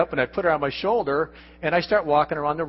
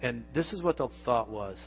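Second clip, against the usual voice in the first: usual speaking voice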